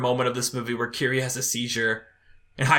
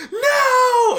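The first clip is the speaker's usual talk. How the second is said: high, loud crying shout; a sudden wailing "No!"; anguished cry; 7 dB louder than usual. Retold or imitated. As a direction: scream out in grief as they kill it. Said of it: "they're like".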